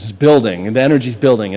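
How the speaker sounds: ordinary delivery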